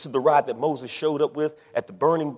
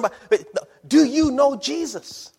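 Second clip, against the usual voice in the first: high, slow, deliberate question; insistent question; 10 semitones higher, 4 dB louder, much slower than usual. Acted. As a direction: cut in and draw the question out. Cuts excuse off with drawn-out question.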